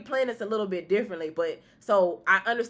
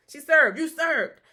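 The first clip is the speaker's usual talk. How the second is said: high, loud, and thrilled; excited praise; 8 semitones higher, 7 dB louder, slower than usual. Retold or imitated. Praising the client's look, loud excited cheer. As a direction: cheer it like applause. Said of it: "I was like".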